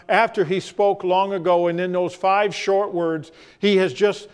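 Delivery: usual speaking voice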